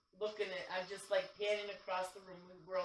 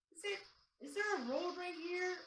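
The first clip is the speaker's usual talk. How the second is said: puzzled; 12 semitones higher than usual. Retold or imitated. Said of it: "I'm going".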